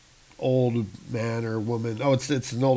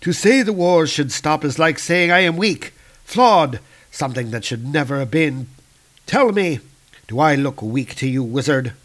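louder, higher cold challenge; menacing dark elf; 3 semitones higher, 5 dB louder than usual. Acted. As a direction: dare him to call you weak. Malekith menacing wizard.

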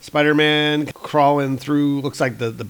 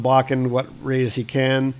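usual speaking voice

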